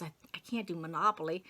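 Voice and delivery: whispered, half-voiced inner panic; panicked whisper. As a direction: breathe it out like a secret you can't say aloud. Panicked inner thought about Monopoly.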